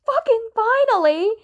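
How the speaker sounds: high, exasperated yelped outburst. A narrator's reading. Tommy finally gets an answer over the radio, yelping. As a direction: yelp it out, pitch jumping high. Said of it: "Tommy yelped".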